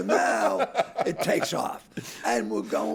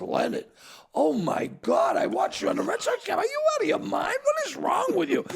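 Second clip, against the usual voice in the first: high-pitched, exasperated mother telling off a son; mock motherly outrage; scolding mother. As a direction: imitate an appalled mother, pitch climbing as the scolding goes on. Retold mother scolding him over broadcast.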